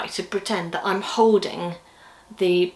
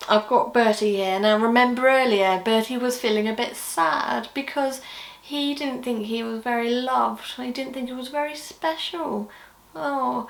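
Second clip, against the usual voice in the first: ordinary delivery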